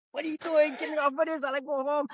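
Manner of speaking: frightened patient begging the doctors to stop; panicked protest. Retold or imitated. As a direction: plead to be let go home. Retold protest while being treated.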